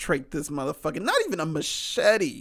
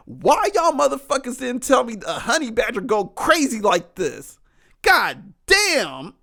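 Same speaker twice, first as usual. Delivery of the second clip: louder and higher, disbelieving; loud shocked exclamation; excited outburst; 7 semitones higher, 6 dB louder than usual. Real, not acted. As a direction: blurt it out, amazed and appalled. Shocked by honey badger videos.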